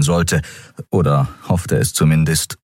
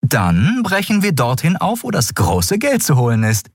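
higher-pitched character voice; lightly acted, eager planner; Endriel, planning; 10 semitones higher than usual. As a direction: lay out the plan, pitched up. Voices Endriel announcing the plan.

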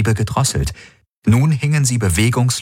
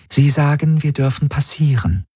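higher-pitched, even interpreter voice; translating Helix; 8 semitones higher than usual. A narrator's reading. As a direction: relay the message evenly, pitched up. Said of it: "übersetzte die Schattenhelix".

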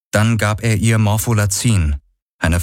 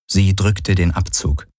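ordinary delivery, nothing marked

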